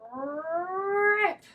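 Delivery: high, loud rip; sound effect rip. Acted. Potato tearing out of the ground, loud rip. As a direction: make the ripping sound.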